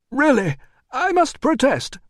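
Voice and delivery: high, loud, indignant official; protesting administrator. A narrator's reading. Administrator objecting to the doorman, high indignant protest. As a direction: protest shrilly and primly.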